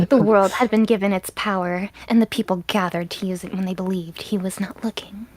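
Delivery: hushed storyteller reading a legend; story narration. Acted. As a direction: read it like an old tale.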